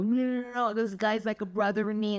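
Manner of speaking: telling her husband the man is like a brother, excited and fast; eager reassurance. Retold or imitated. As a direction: rush to set the husband straight. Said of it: "I go".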